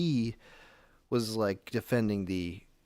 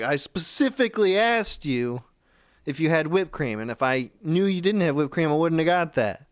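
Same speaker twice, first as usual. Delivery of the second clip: higher, louder indignant complaint; retold irate customer, pitched up; mad customer; 5 semitones higher, 4 dB louder than usual. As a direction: scold the worker, voice raised. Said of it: "she was getting mad at this guy".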